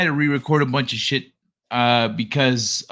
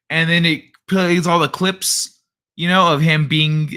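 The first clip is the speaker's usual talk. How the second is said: ordinary delivery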